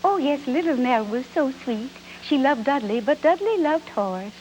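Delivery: sweet high little Nell voice. Pretend. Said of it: "It's so, do that".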